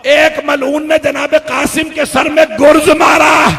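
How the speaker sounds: loud, high battle narration shouted; preaching at full force. Acted. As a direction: drive the battle scene at the crowd.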